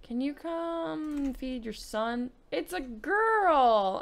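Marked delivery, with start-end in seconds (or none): 2.5-4.0 higher, louder and drawn out slowly; surprised announcement. Acted. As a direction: announce it like a shock.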